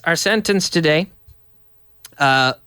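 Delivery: ordinary delivery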